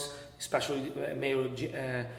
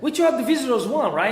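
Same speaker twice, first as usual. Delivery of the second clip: laughing through the words, higher and louder; 8 semitones higher, 5 dB louder than usual. Real, not acted. Golf center owner laughing to the host about visitors. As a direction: chuckle out the point. Laughing while making a point.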